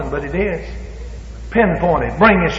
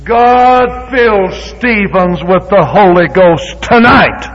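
slow emphatic prayer; 2 semitones higher, 7 dB louder, much slower than usual. Shown as a demonstration. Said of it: "pinpoint prayer demo".

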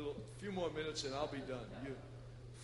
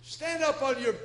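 louder, higher command to rise; commanding call; 6 semitones higher, 9 dB louder than usual. Retold or imitated. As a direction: order the cripple to stand. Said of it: "and he says".

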